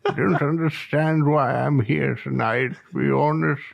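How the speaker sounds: put-on a light, drawn-out old English gent; hosting a podcast as an old Englishman. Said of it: "finish the podcast in that voice".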